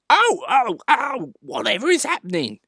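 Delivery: high, loud silly squeals; mock screaming. A narrator's reading. Trucks bumping together, hammed-up screaming. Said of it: "they bump into each other screaming".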